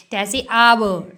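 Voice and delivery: आब with a bouncing echoed ब at the end; qalqala on ba. Shown as a demonstration. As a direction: let the ब of आब rebound. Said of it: "बा की आवाज़ को लौट कर वापस आ रही है". (आब में बा पर कलकला)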